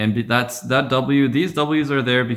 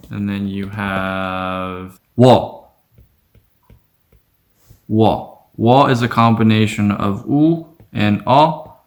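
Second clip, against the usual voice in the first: ordinary talk, nothing marked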